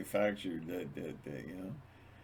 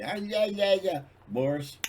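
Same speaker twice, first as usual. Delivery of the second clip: calling bodyguard; 11 semitones higher, 6 dB louder than usual. Pretend.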